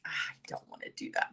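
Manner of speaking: quick, hushed whine of not wanting to; a fast, secretive little mutter of protest; whispered reluctance. Retold or imitated. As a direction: whisper it like a guilty aside to yourself. Retelling her reluctance at being asked to stand.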